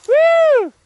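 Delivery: loud, high whoop; cheering whoop. Real, not acted. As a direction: whoop them down the hill. Cheering a sledder on.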